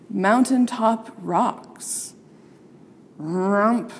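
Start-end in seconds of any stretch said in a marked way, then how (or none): 3.2-3.9 a lightly voiced bear grunt; bear bellow. A narrator's reading. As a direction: give a mild bear rump from below. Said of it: "bellowed the bears from below".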